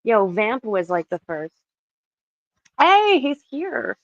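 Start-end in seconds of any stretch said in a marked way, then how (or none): 2.8-4.0 high, loud and slow welcome; light up as a friend arrives; excited greeting. Real, not acted.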